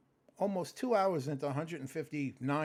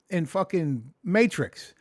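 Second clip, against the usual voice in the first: usual speaking voice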